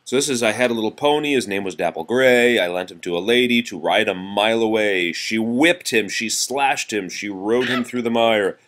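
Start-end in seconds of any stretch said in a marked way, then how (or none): none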